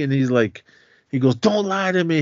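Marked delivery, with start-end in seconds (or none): 1.3-2.2 high-pitched mock yell, little added volume; retold yell. Sonny accusing C against the wall, mild mock yell. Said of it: "he's just yelling at him".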